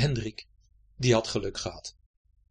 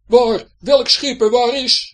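high, loud, alarmed yelling; startled shouting; 10 semitones higher, 14 dB louder, slower than usual. Acted. Pirates storming on deck shouting in alarm. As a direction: bark out confused questions. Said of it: "riepen ze nog".

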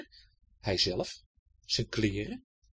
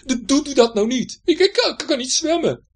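high, loud stuttering plea; frightened stammer; 12 semitones higher, 10 dB louder than usual. Acted. Hendrik pleading with Betje, frightened. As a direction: stammer out that you can't swim. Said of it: "riep Hendrik angstig".

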